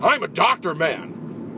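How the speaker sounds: hammed-up drawn-out heckle; Will Ferrell. Audience member echoing the doctor line back, lightly imitated comic voice. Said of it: "it sounded like Will Ferrell".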